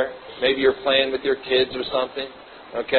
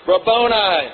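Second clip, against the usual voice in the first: Rabboni cried out higher; crying out; 6 semitones higher than usual. A narrator's reading. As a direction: call out Rabboni in joyful recognition. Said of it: "cried out in Aramaic".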